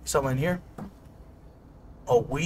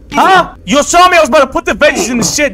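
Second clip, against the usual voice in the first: higher, louder, fast indignant shout; indignant yelling; 6 semitones higher, 7 dB louder than usual. Acted. Scolded while cooking, yelling back at her partner indignantly. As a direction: yell that she was about to do it. Heated argument in kitchen.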